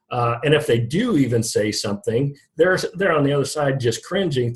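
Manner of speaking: ordinary delivery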